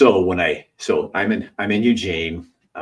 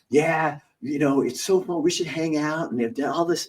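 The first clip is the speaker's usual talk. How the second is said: 1 eager invitation; 9 semitones higher than usual. Retold or imitated. Bully inviting to hang out.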